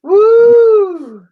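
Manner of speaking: high, loud whoop; cheering woo. Real, not acted. Guy on stream whooping loudly at a comment. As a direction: whoop it up to the chat.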